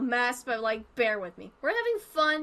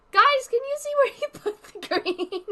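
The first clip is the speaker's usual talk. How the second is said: laughing; 9 semitones higher, 5 dB louder than usual. Real, not acted. Laughing while asking viewers.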